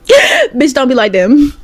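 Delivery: laugh, then push the warning harder; laughing emphatic repeat. Shown as a demonstration.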